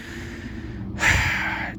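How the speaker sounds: long, heavy exhale; exasperated sigh. Real, not acted. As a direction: let out a long sigh of disbelief. Exasperated reaction to the scene.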